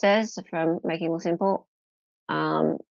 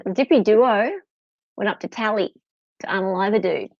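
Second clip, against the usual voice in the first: a hint of Australian accent, louder; mock Australian accent; 3 semitones higher, 7 dB louder than usual. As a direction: playfully reread it with an Aussie twang. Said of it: "You sound like an Aussie".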